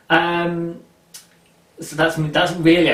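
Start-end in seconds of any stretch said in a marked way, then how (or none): none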